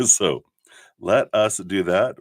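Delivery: ordinary delivery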